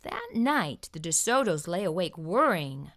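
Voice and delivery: plain reading voice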